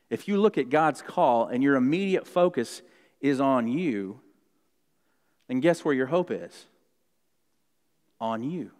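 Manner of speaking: ordinary talk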